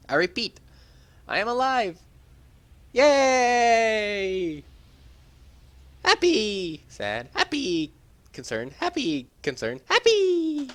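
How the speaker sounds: loud high squeals of happy, flat drops for sad and concerned; flipping happy and sad. Acted. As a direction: bounce between joy and worry for fun. Playful mood switching voices.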